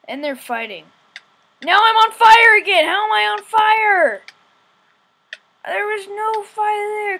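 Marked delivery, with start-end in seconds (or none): 1.6-7.2 higher and louder, rattled; a gamer yelling as he catches fire; alarmed surprise. Real, not acted. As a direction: protest that there was no fire. Suddenly on fire in game.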